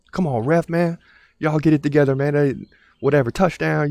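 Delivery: yelling at the tv. Retold or imitated. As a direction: holler at the screen. Man yells at game on TV.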